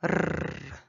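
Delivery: R as a long rolled trill; isolated tongue-roll sound for R. Shown as a demonstration. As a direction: trill the R alone, low and sustained. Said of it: "ça se prononce".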